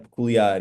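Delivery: peculiar said the Portuguese way; Portuguese pronunciation. Shown as a demonstration. Showing the host how the name sounds, peculiar in Portuguese. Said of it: "in Portuguese".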